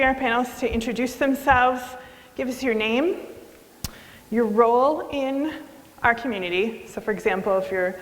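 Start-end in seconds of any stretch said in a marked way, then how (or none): none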